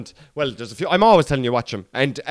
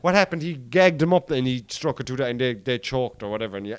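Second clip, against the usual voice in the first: normal talking voice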